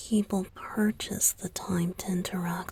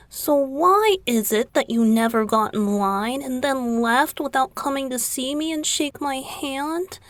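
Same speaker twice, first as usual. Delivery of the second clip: louder, pouty reproach; upset complaint; 5 semitones higher, 8 dB louder than usual. Acted. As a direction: scold the fan for skipping you. Idol sulks at absent fan.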